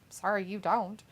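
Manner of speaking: usual speaking voice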